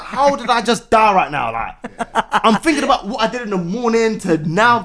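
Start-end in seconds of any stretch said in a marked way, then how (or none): 0.0-1.5 retold angry disbelief. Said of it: "I was like".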